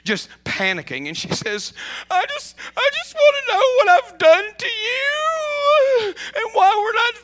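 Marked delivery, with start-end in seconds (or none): 2.1-7.1 high, loud crying plea, voice breaking; tearful pleading. Retold or imitated. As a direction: beg through tears, much higher and louder. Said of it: "she calls me in tears, just panicking".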